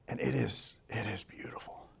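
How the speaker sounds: soft, low, almost whispered; barely voiced, trailing into a hush; hushed awe. Real, not acted. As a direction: let it fade to a reverent near-whisper. Recalls calm before the crash.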